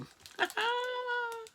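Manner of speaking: high giggly squeal of a laugh; delighted laugh. Real, not acted. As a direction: burst out in a high happy giggle. Laugh after owning Blade.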